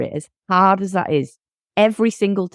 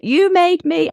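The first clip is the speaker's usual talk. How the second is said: put-on child blaming; 11 semitones higher, slower than usual. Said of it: "my six-year-old Nia is, is always like".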